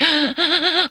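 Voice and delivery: sharp, high gasp of delight; a thrilled little gasp; excited gasp. Real, not acted. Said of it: "heart palpitations excited".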